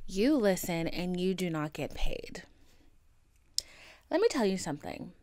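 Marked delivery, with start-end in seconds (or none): 0.9-2.4 whispered aside. Real, not acted. Deadpan aside to listener.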